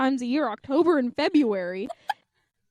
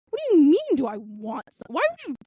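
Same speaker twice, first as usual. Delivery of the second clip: outraged retort; 2 semitones higher, 3 dB louder than usual. Retold or imitated. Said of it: "he was like".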